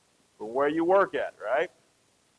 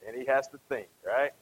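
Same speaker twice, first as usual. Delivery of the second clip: ordinary talk, nothing marked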